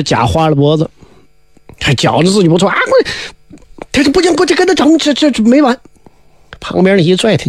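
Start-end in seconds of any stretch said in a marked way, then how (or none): 2.7-5.8 high, squeaky voice of a boastful hothead; a blustering braggart's high squawk; sputtering, much higher cocky fighter; cocky challenger. Acted. Boastful challenger wants more fighting.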